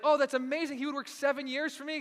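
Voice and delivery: high, loud gushing delight; swooning woman. Retold or imitated. A woman imagining a man working seven years for her, gushing. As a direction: gush like a swooning admirer. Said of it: "any woman would be like".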